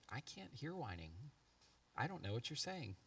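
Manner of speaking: a hint of mom gently refusing to listen; lightly voiced mother. Imitates wife refusing whining.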